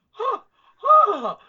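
high, shocked gasping; a stunned son's gasp; very high, breathless "huh, huh". Retold or imitated. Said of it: "I was like".

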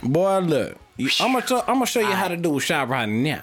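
put-on cocky good-old-boy Southern accent; Southern American accent. Player offers shot lesson, Southern American accent. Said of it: "Southern American right there".

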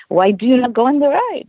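a young ride operator's hint of a French accent; light French accent. Ride operator asking why they skip the ride, light French accent. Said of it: "kinda had a little French accent".

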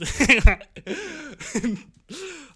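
bursting out laughing; high loud laugh. Real, not acted. Laughs at own failed accent.

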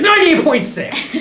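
very high, loud shout imitating the roommate; mock shouted readout. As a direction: bellow the reading as a punchline. Roommate triumphantly reads out, retold.

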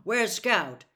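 loud, urgent order; sharp command. A narrator's reading. Father orders a doctor called for his hurt son, sharp and loud. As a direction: bark it down the hall. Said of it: "Atticus's voice came sharply".

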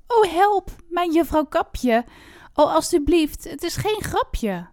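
high, urgent storybook plea; pleading for help. Acted. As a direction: play a panicked piglet begging on the telephone. (gespeeld smekend, in nood)

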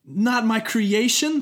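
indignant thunder. Acted. Hephaestus rejecting his apprentice's claim, indignant thunder. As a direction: roll it out, offended. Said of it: "thundered Hephaestus indignantly".

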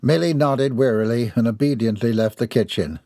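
plain reading voice

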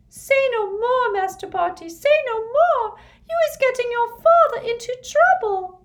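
very high, loud squealing plea; frantic house-elf. A narrator's reading. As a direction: beg desperately in a tiny shrieking voice.

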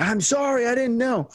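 high, put-on condescending sorry; condescending apology. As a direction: apologize while talking down to him. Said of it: "that's a little condescending attitude".

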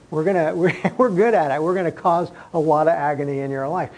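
normal talking voice

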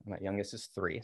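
normal talking voice

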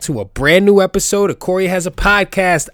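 loud, high hype-man announcer; hyped podcast intro. Real, not acted. Host welcoming listeners back to the podcast, hyped announcer. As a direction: open the show like a party hype man, building to a shouted sign-off.

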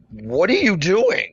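high, drawn-out disbelieving question; retold incredulous challenge. Barista finds a woman behind her in the back, incredulous. Retelling startled confrontation with intruder.

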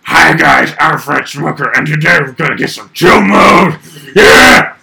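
loud gritted-teeth shouting influencer; Fed Smoker. Retold or imitated. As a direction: yell it through clenched teeth, brother-style. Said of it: "To talk like Fed Smoker, you just gotta grit your teeth together".

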